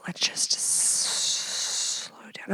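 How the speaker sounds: very slow soft whisper; hushed whisper. Retold or imitated. Host imitating Derek calming her down, slow whisper. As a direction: breathe it out slowly, barely voiced. Quoting Derek gently slowing things.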